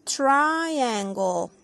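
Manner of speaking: triangle said with an English a, slow and clear; English pronunciation. Shown as a demonstration. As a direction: model triangle the English way for kids. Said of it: "en inglés se dice".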